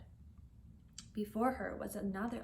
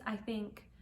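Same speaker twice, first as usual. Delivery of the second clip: ordinary delivery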